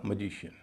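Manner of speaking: "मैजिशियन" as muh-JI-shun; first vowel of "मैजिशियन" reduced to uh; correct pronunciation. Shown as a demonstration. Teacher applying the stress rule, correct pronunciation. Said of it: "तो इसका उच्चारण होगा".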